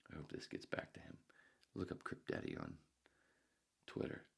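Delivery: ordinary delivery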